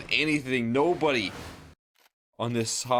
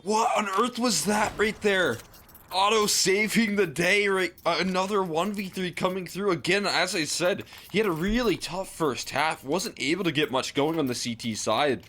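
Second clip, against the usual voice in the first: high, excited co-caster reaction; amazed commentary; 7 semitones higher than usual. Real, not acted. Co-caster hailing Otto saving the day, amazed commentary. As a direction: start astonished, then ease down gradually while staying high.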